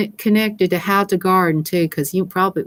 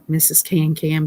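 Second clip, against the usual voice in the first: usual speaking voice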